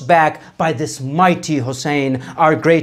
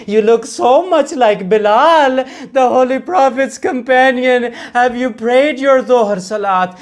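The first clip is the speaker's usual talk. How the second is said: gentle plea; 6 semitones higher than usual. Acted. Hussein gently addresses the slave.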